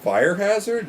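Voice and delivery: high, loud disbelieving echo; incredulous. Real, not acted. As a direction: repeat it like you can't believe it. Echoes remark in disbelief, much higher.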